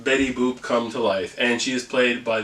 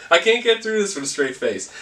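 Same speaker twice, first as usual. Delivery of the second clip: ordinary delivery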